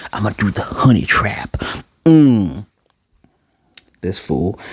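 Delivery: scheming little whisper. Retold or imitated. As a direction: whisper the plan to yourself. His inner scheming thought.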